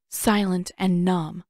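usual speaking voice

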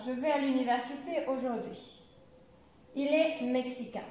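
falling end on AUJOURD'HUI and MEXICAIN; demonstrated statement tune, "aujourd'hui" and "mexicain" going down; affirmative intonation. Shown as a demonstration. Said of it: "comme si l'accent chutait à la fin".

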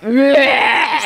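exaggerated mock vomiting noise; put-on throwing-up sound; imitated retch. Friend imitating drunk man sick in the bathroom, mock retching. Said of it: "he was like".

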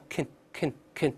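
"kin" said short and unstressed, with a schwa; three separate light, reduced "kin" syllables; weak syllable. Shown as a demonstration. Teacher showing the unstressed prefix, weak syllable. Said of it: "前面轻读，发kin".

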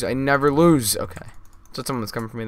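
normal talking voice

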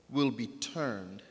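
ordinary talk

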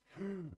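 sharp, high gasp of delight; excited gasp. Real, not acted. Player spots potatoes in the mod menu, sharp excited gasp. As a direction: gasp like you just spotted treasure.

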